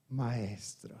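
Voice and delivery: Mary quietly recognising her teacher; hushed recognition. Acted. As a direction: breathe the word as she realises.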